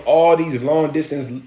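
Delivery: ordinary delivery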